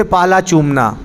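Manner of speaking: normal talking voice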